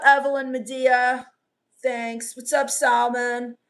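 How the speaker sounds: ordinary delivery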